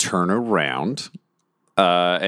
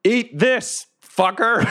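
battle cry; 12 semitones higher, much slower than usual. Acted. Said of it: "And I yell".

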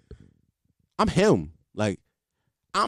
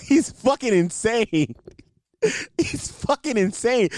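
higher pitch, slowed down between laughs; real amused incredulity; laughing disbelief; 6 semitones higher, slower than usual. Real, not acted. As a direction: stretch the words out while cracking up. Cracking up at nephew's behaviour.

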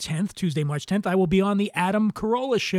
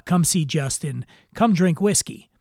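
usual speaking voice

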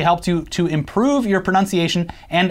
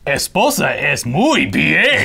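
bad Spanish; 3 semitones lower, slower than usual.

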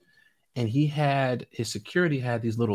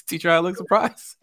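Damon teasing; 6 semitones higher, 3 dB louder than usual. Retold or imitated. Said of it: "he goes".

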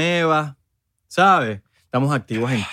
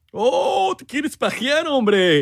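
muy agudo, escandalizado en broma; sobreactúa la sorpresa con voz aguda; voz rara fingida; 9 semitones higher, much slower than usual. Pretend. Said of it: "por qué estamos hablando así". (asombro impostado de parodia)

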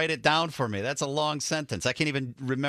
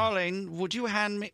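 light British accent; 6 semitones higher than usual. Shown as a demonstration. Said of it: "Or darling, if that's more fancy".

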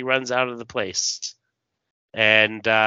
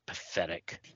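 much softer, lower sneer; sinister low voice; 5 semitones lower, 14 dB quieter than usual. Acted. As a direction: sneer it from the shadows. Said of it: "then we hear".

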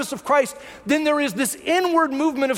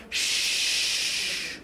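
a soft, breathy shh to quiet a friend; gentle shush; 7 dB quieter than usual. Retold or imitated. Jesus hushing an eager Peter, soft shh. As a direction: hush him softly, unvoiced. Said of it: "Jesus is probably thinking like".

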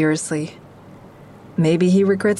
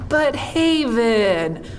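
higher and slow, a put-on questioning voice; mock audience voice; 6 semitones higher, much slower than usual. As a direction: drag it out like a baffled heckler. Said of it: "You're all thinking".

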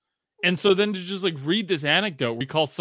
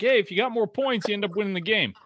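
simple coach talk; 5 semitones higher, faster than usual. Retold or imitated. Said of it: "he'd say something so simple".

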